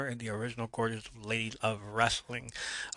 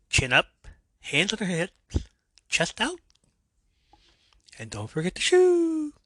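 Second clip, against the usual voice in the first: lightly sing-song cues, rising higher at each end; mock posing cues; 8 semitones higher, 4 dB louder than usual. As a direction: call out posing cues, stretching the last word up high. Acting out posing cues, joking shout-out.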